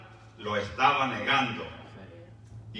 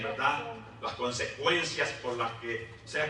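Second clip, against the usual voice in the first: usual speaking voice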